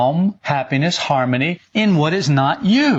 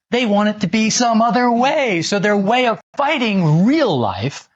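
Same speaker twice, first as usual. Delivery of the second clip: ordinary delivery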